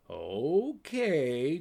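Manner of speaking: lilting, drawn-out okay; a playful sing-song okay; sing-songy. Acted. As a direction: sing it teasingly to the raven. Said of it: "in his sing-songy voice".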